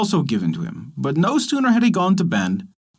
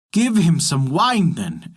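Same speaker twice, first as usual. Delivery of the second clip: plain reading voice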